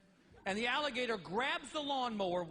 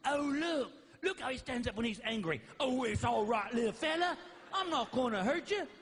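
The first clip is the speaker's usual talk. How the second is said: lightly hammed-up Australian wildlife host; mock reassuring croc-hunter voice; Steve Irwin impression; 3 semitones higher than usual. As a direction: coo at a dangerous animal like a TV naturalist. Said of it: "He's going".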